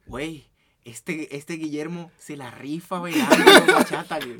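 slow, drawn-out friend impression with a laugh; lightly voiced amused friend; imitating Jorge. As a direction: drag it out like a buddy gossiping. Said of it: "me llega Jorge y me dice".

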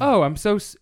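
high, loud chirpy robot apology. Retold or imitated. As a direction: play the cheerful phone bot saying sorry. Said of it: "it's a f-, full robot".

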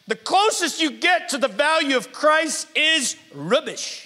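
full-voiced pulpit shout; preached shout. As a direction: ring it out to the back row. Preacher quoting Paul, climactic.

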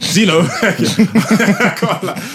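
loud, giggling through the words; laughing at his own answer. Real, not acted. Laughing about his teammates.

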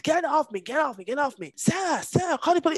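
high-pitched, urgent mock screaming; retold scream. Woman re-enacting her screams at the man holding her down, retold screaming. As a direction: re-enact frantic screams for help. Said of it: "Like, I was screaming".

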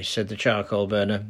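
usual speaking voice

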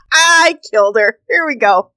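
ordinary talk

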